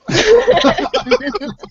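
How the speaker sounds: high, loud burst of laughter. Real, not acted. Player laughing at own joke, high loud laugh. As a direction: cackle at your own line.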